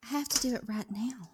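whispered, eager; hushed and urgent. Real, not acted.

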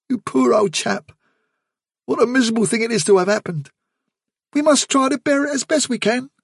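high, kind voice; Blackberry consoling Fiver, gentle kindness; gentle young rabbit. Acted. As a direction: comfort a grieving friend. Blackberry speaks.

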